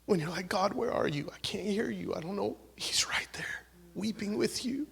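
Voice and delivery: tender, whispered comfort over the congregation; hushed whisper. Real, not acted. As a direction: lean in and breathe the words to the hurting. Emotional ministry moment, hushed.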